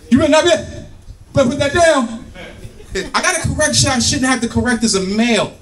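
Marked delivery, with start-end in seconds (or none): 0.1-2.2 threatening dad yell. Acted. Dad warning the kids, threatening yell. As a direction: scold them at the top of your pitch. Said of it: "you have to yell in that threatening voice".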